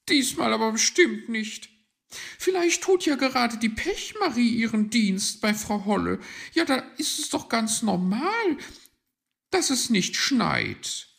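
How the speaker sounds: very high, loud magpie voice; high, insistent bird figure; sceptical magpie. Acted. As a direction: argue loudly, pitched right up.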